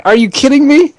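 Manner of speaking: high incredulous rise; high, rising mock disbelief. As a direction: act out his teen outrage. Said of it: "And I was like".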